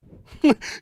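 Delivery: big loud laugh; loud burst of laughter. Real, not acted. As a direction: crack up loudly. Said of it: "Yo, if you say partner".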